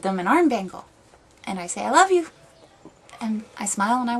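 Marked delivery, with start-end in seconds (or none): 1.8-2.3 high, loud retold endearment; retold declaration of love. Retelling gift of arm bangle, high effusive endearment. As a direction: lift it high and loud, quoting yourself. Retelling words to bar strangers.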